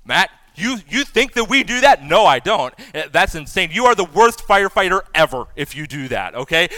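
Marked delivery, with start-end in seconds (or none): 0.0-2.0 higher, louder mock protest; mock indignant listener. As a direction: play the offended firefighter in the crowd.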